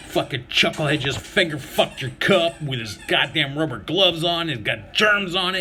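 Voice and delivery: ordinary talk, nothing marked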